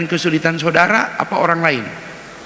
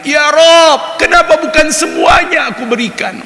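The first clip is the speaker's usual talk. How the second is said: high, loud anguished wail to God; anguished cry; 10 semitones higher, 5 dB louder than usual. A narrator's reading. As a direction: cry out in regret, voice pitched high. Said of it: "Maka dia teriak". (teriakan pilu bernada tinggi)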